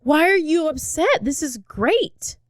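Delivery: high-pitched oblivious delight; mock-thrilled, stretching the last words; imitated super excitement. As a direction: gush like an eager, clueless sidekick. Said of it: "So he's super excited".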